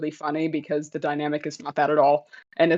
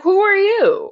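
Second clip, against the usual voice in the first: mimicking Rose; 13 semitones higher, 6 dB louder, slower than usual. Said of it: "she's like".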